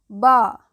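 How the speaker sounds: बा said as a loud, short "ba"; B sound. Shown as a demonstration. Teacher showing how B sounds, बा said loudly. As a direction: pop बा out loud and clipped. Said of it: "बी का उच्चारण जो होता है वो ब के रूप में".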